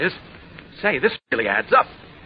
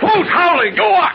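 indignant retort; 13 semitones higher, slower than usual. Acted. Scolded for howling, denies.

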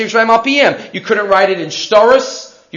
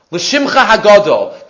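usual speaking voice

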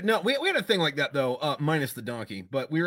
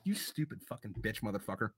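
1 muttered curse; 4 semitones lower, 13 dB quieter than usual. Retold or imitated. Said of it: "we're like".

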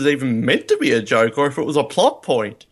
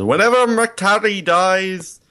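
high, drawn-out mock proclamation; woman in the film; 8 semitones higher, slower than usual. Woman explaining traditions in the film, high slow mock proclamation. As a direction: intone it slowly, pitched up. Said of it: "she goes".

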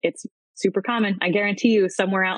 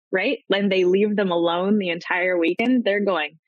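ordinary talk, nothing marked

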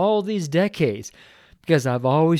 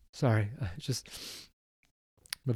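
tearful; 4 semitones lower, 11 dB quieter, faster than usual. Real, not acted. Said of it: "very tearful man".

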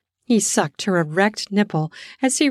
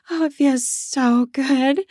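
pleasured sigh; 5 semitones higher, slower than usual. Acted. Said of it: "She sighed her sweet feminine sigh".

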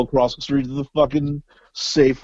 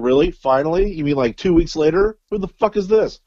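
incredulous; 7 semitones higher than usual. Retold or imitated. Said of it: "I'm like".

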